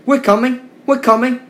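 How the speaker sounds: high, loud call ahead. Acted. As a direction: shout reassurance to a runaway friend. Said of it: "called Edward".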